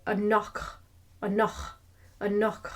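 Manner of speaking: anach ending in a throaty ch; Elvish anach. Shown as a demonstration. Teacher drilling the Elvish anach. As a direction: say anach with a rasp at the end. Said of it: "more like the loch".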